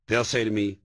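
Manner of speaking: ordinary talk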